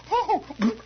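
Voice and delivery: put-on high, alarmed Hungarian-accented protest; mock Hungarian panic, pitched high; nervous Hungarian lady. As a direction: imitate a flustered Hungarian woman objecting. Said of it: "nervous Hungarian lady".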